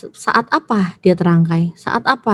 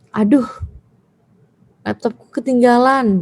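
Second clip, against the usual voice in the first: mildly raised, lightly acted dismay; dismayed exclamation; 3 semitones higher than usual. Shown as a demonstration. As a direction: demonstrate a rueful "oh no". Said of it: "itu untuk ekspresi". (keluhan kaget ringan)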